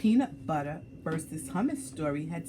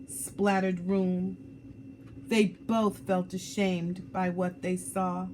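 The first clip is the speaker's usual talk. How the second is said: ordinary delivery, nothing marked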